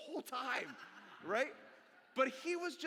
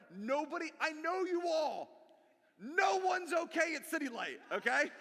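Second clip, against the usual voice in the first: emphatic preaching; 9 semitones higher, 8 dB louder than usual. Real, not acted. Preacher stressing a point.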